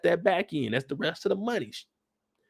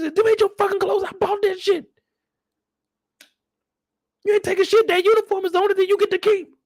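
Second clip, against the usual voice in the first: angry father; faster than usual. Retold or imitated. Father yelling back about the clothes, high angry. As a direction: blow up at the son.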